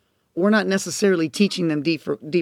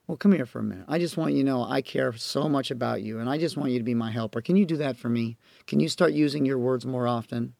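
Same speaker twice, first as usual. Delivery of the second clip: soft, gentle coaxing; tender mom; 5 semitones lower, 7 dB quieter than usual. Retold or imitated. Mother coaxing upset son, soft and tender. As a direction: lean in and reassure a small child.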